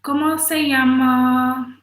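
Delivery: slow, hammed-up American Spanish accent; mock Spanish of a non-speaker; bad Spanish accent. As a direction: say "¿Cómo se llama?" slowly like a gringo tourist. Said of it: "doesn't speak a lick of Spanish".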